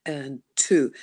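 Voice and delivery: and one and two drawn out slowly; slow counted beat. Shown as a demonstration. Voice teacher showing the singers a slow beat. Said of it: "it's like a one and two".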